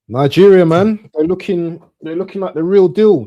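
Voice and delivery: loud, emphatic hype; big them up with energy; hyped praise. Real, not acted. Pundit hyping the Nigerian team, loud and excited.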